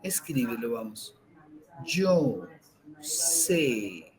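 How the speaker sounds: slow, soft meditation voice with long pauses; guided meditation. Acted. Teacher guiding listeners through breathing, calm meditation voice. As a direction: breathe the words out, calm and unhurried.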